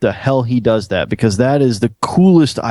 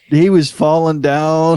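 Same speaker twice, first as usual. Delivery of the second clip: ordinary delivery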